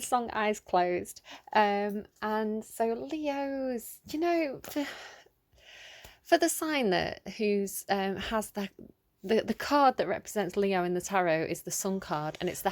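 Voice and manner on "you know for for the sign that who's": ordinary talk, nothing marked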